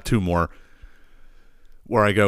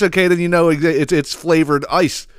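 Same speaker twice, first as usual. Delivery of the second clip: ordinary talk, nothing marked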